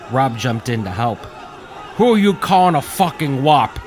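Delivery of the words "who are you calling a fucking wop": high, loud enraged retort; hurled back. A narrator's reading. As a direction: fling the insult back. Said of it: "Willie hurled back".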